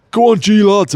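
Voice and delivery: high, loud mock Northern fan shout; a hammed-up Northern cheer; bad fan accent. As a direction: call it out like a fan at the roadside, badly accented. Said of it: "it's really bad accents now".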